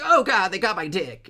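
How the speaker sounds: high, loud hammed-up cry of horror; comic shriek for help; mock panic. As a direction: wail it in exaggerated terror. Acted victim grabbed by demons.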